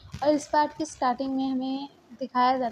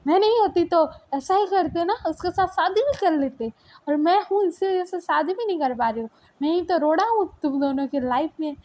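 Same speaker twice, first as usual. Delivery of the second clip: higher and louder, bitter reproach; angry accusation; 5 semitones higher, 4 dB louder than usual. Acted. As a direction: hurl the blame at him, hurt. Li angrily accusing boyfriend. (ऊँची और तेज़ आवाज़ में कड़वा ताना)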